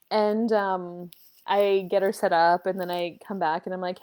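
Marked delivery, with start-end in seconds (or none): none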